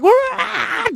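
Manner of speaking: high-pitched "wah!"; excited wail. Retold or imitated. 52-year-old showing what he still does, wailing high. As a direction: let out a high, rolling whoop. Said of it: "I go like".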